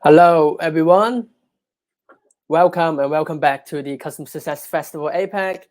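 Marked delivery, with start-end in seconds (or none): none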